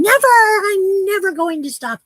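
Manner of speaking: high, loud, gleeful imp voice; inner little demon. Retold or imitated. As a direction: squeak it out like a mischievous little devil. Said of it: "that little voice in me going".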